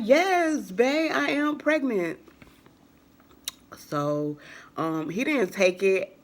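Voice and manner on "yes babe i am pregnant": high, emphatic retold answer; exasperated insistence. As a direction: insist as if asked once too often. Exasperated answer to doubting boyfriend.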